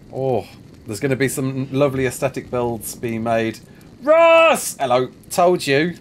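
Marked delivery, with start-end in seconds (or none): 4.0-4.8 high, loud call across to a friend. Real, not acted. Calling a friend over in the game, loud high call. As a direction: shout his name to get his attention.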